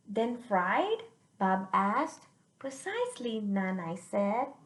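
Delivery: put-on lively read-aloud for kids; expressive children's story reading; storybook narration. A narrator's reading. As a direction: read it out like a picture book to children. Reads the picture book aloud.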